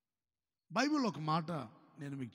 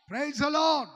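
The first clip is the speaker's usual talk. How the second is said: ringing, higher Praise the Lord; loud praise; 6 semitones higher, 8 dB louder, much slower than usual. Real, not acted. Pastor calling out praise to the congregation, loud. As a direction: call it out to the crowd. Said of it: "గట్టిగా ఆమేన్ చెప్తారా".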